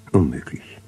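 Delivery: ordinary delivery, nothing marked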